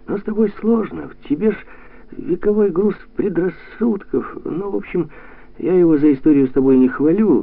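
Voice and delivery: high, animated voice; lively, high-pitched man making his case; brisk lecturing. A narrator's reading. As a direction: scold lightly while keeping it upbeat. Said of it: "Тимоша говорил бодро".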